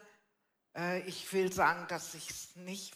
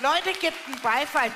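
rousing shout to the audience; loud call to crowd; 9 semitones higher, 14 dB louder than usual. Real, not acted. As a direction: call out for applause, loud and high. Calls audience to applaud.

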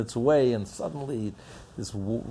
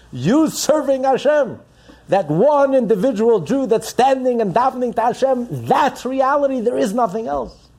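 higher, louder, proclaiming each word; fervent preaching; 11 semitones higher, 7 dB louder than usual. Acted. Rabbi voicing the Baal Shem Tov, preaching loud and high. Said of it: "so the Baal Shem Tov says".

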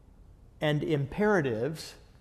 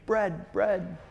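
ordinary delivery

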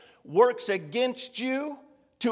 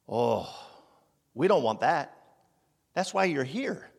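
normal talking voice

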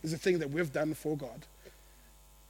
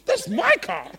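higher, louder mock whine on "my car"; possessive whine; 7 semitones higher, 5 dB louder, slower than usual. Churchgoer mocking a jealous believer, put-on whine. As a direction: stake your claim in a put-on voice. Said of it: "Why do I sound like you".

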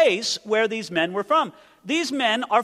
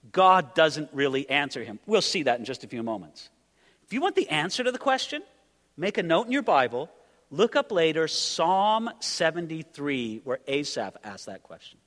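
ordinary talk, nothing marked

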